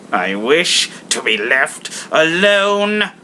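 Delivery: put-on slow, curt old miser; a firm old man's refusal; cold Scrooge. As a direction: shut them out.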